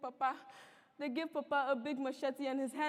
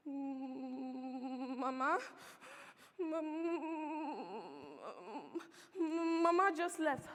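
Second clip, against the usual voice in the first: frightened; much slower than usual. Acted. Frightened girl answers armed men.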